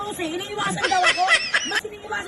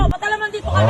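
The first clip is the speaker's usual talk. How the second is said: ordinary talk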